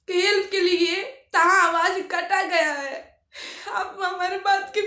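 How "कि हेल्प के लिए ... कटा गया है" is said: louder, forceful protest; emphatic insistence. Real, not acted. Vlogger insisting to viewers her words were cut, emphatic. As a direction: hammer each phrase out louder.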